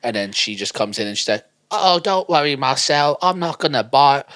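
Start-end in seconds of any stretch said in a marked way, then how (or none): 1.7-4.2 high, loud put-on voice; mock breezy model. Model reassuring the footballer, high acted voice. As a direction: brush the worry off, hammed up.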